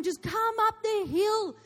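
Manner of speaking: high, loud, drawn-out coaxing call; retold calling out. Mother to son down the backyard, calling out. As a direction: holler uphill encouragement to a child.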